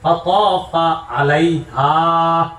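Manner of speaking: drawn-out Quranic chanting; slow recitation. Acted. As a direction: intone it slowly like recitation.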